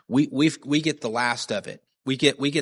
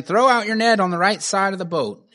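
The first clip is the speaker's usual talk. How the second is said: high, louder shouted instruction; calling out; 8 semitones higher, 5 dB louder than usual. Acted. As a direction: call it out to the fishermen. Jesus calls instructions to boat.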